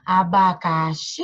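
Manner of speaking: a-ba-ca-XIIII, slowly, last syllable held long; calling out the word. Shown as a demonstration. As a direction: draw out the final XI of Abacaxi. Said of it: "Vamos chamar abacaxi?".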